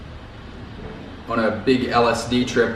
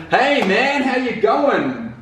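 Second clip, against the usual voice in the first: much higher, slow, chirpy mock hello; mock Western greeting; 11 semitones higher, slower than usual. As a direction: parody a cheery Westerner saying hi. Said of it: "Can you imagine?".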